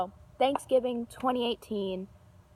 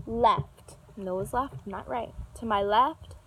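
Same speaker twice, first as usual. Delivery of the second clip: ordinary delivery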